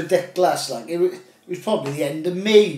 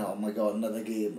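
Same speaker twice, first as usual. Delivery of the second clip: weary groan; 6 semitones lower, 9 dB quieter than usual. Retold or imitated. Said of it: "we were just thinking".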